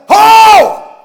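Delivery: high, booming plowman's holler. Acted. Plowman hollers a command to his mule, hollering. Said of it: "always hollering".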